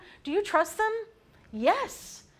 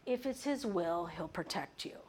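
hedging tradition; 8 dB quieter, slower than usual. Acted. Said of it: "tradition says".

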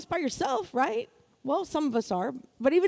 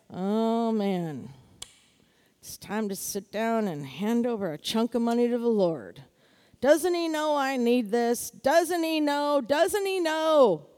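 mock reluctant grumble, drawn out; grudging attitude; 3 semitones lower than usual. Imagined churchgoer resenting the offering, grudging mock complaint. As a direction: complain like a put-upon giver dragging it out. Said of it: "Don't have that attitude".

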